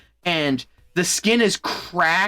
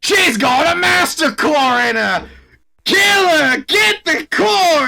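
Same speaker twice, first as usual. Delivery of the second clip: high, much louder battle orders; commander's shout; 11 semitones higher, 7 dB louder, slower than usual. Retold or imitated. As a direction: bellow it at the troops, slow and hammy. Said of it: "their leader goes".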